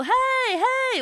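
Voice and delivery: high, loud call of hey. Shown as a demonstration. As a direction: call out hey like across a field. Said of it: "calling out".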